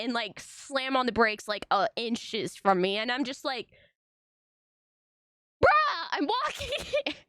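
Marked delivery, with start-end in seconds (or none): 5.6-7.1 high, drawn-out indignant squawk; squeaky mock outrage, slowly; mock outraged protest. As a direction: protest to a careless driver, hammed-up. Acted protest at a driver.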